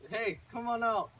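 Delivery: mother's brisk call. Acted. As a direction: call the kids outside. Mother calls children out.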